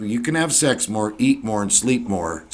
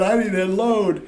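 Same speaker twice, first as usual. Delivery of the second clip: mock junkie voice; 9 semitones higher than usual. Acted junkie thought at mirror.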